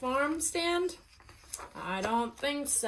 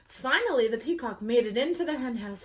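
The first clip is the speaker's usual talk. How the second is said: usual speaking voice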